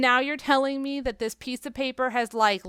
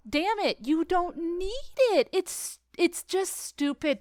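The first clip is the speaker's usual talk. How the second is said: higher, slow exasperated retort; mock irritation; 6 semitones higher, slower than usual. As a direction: grumble at the screen in disbelief. Annoyed viewer retort.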